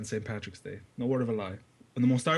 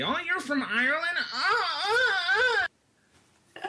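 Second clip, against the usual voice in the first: very high, breathless mock gush over an Irish accent; gushing American tourist; much slower than usual. Irishman mocking Americans who fawn over him, high gushing squeal. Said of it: "they're try-hard bitches on Paddy's Day".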